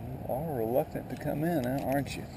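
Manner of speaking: normal talking voice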